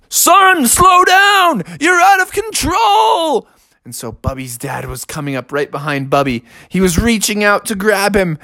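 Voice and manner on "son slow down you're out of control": very high, loud warning; panicked father shouting. Acted. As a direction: yell after a runaway skier. Father chases runaway cub.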